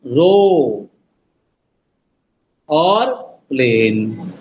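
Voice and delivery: रो and प्लेन drawn out low, one at a time; teacher counting off रो and प्लेन as syllables; separated syllables. Shown as a demonstration. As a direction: hold रो, pause, then a slow low प्लेन. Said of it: "ये तीन सब syllable से बना".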